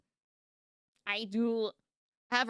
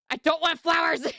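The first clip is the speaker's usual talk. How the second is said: higher, louder blunt refusal; emphatic shout; 6 semitones higher, 7 dB louder than usual. Shown as a demonstration. Showing how to be direct, emphatic shout.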